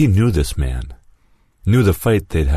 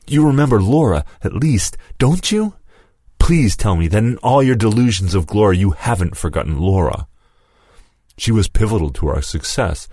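higher, pleading and accusing; grieving accuser; 6 semitones higher than usual. Acted. Lewis reminds Mason of Laura, grieving and accusing. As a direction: push the hurt up into the voice.

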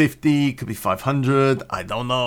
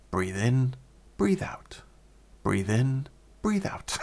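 recorded breathing instructor; 5 dB quieter, slower than usual. Retold or imitated. Voice in the blonde's headphones, soft instructional chant. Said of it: "there's a voice going".

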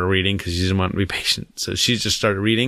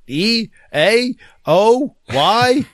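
each letter a high questioning rise; unsure kid sounding out guesses; child guessing; 11 semitones higher, 5 dB louder, much slower than usual. Retold or imitated. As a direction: guess letters hopefully, pitched way up. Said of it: "She's like".

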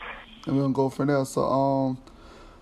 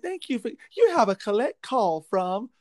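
lightly voiced automated operator; a hint of a recorded phone lady; collect call operator voice; 5 semitones higher than usual. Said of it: "Listen to that lady talk to us".

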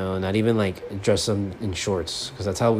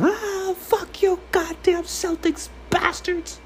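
very high strained mock scream; loud fan outburst; much slower than usual. Shown as a demonstration. Fan cursing the Celtics despite the neighbors, mock screaming. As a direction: screech it as a held-back yell, drawn out. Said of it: "I was getting a little bit loud".

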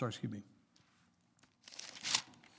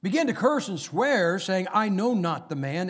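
loud, higher scripture read; full-force preaching; 4 semitones higher, 6 dB louder than usual. Acted.

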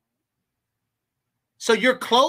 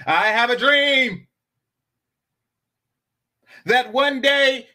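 "I have a dream" slow, louder and higher, with long pauses; dramatic speech pause; 6 semitones higher, 4 dB louder, slower than usual. Shown as a demonstration. Showing the chat perfect pause timing with a famous speech line. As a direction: orate "I have a dream" like a civil rights speech, pausing for effect. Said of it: "For example".

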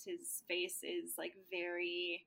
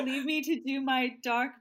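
hammed-up Vlad voice, a little louder; mock brooding vampire; 4 semitones higher, 7 dB louder than usual. Vlad sending the others off to the masquerade, mock brooding. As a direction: play the gloomy vampire, half joking. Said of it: "he says, like".

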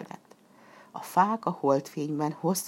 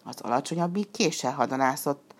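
ordinary delivery, nothing marked

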